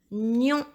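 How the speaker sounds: Nion. held on its opening gn sound; isolated Nion., rising at the end; dictated syllable. Shown as a demonstration. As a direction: draw out the start of "Nion.". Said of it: "une dictée de syllabes".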